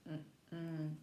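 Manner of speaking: ordinary delivery, nothing marked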